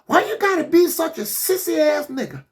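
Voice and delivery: high, mock scolding wife; nagging wife. Job's wife mocking her sick husband, high scold. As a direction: scold him like a fed-up wife. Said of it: "his wife is like".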